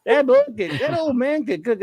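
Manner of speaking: a hint of a Cajun cook bragging; light Cajun voicing. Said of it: "He say".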